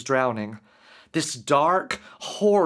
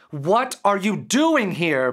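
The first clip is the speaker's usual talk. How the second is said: higher, louder startled outburst; blasting demand; 5 semitones higher, 3 dB louder than usual. Acted. Derek shouting at the demon in his room, loud outburst. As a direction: fire it at the intruder. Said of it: "blasted Derek".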